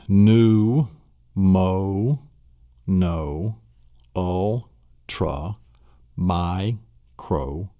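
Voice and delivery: "new, Mo, no, ultra, micro" each set apart by a pause; syllable by syllable. Shown as a demonstration. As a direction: sound out the long word in slow, isolated chunks. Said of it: "let's look at the syllables of this".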